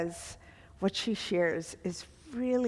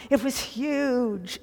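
slow, higher mock exaggeration; gushing superlatives; 5 semitones higher, 4 dB louder, slower than usual. Teacher mimicking how we talk in superlatives, gushing. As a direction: stretch it out in playful overstatement. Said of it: "we speak in superlatives".

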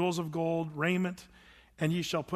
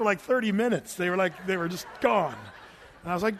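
usual speaking voice

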